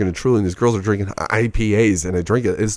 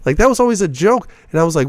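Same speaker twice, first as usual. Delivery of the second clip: normal talking voice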